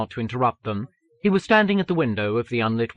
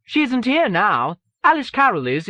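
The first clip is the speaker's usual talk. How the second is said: high, louder secretary's voice; 12 semitones higher, 5 dB louder than usual. A narrator's reading. Secretary redirecting an author to the new editor, high-pitched. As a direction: inform the caller in a high secretary voice. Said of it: "Kerris' secretary said".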